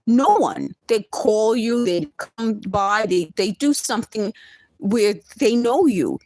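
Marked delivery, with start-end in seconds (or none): none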